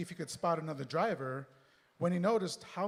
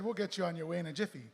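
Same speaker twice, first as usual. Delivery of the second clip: rushed, fast line of the bus driver; fast read; faster than usual. Shown as a demonstration. Reader rushing the line after being asked for two times speed. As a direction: rattle it off at double speed. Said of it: "I'm trying to read at two times speed".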